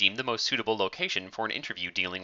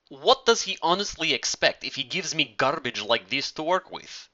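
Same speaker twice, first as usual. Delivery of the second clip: put-on exasperated Russian accent; 6 semitones higher than usual. A narrator's reading. Talia complaining about the producer.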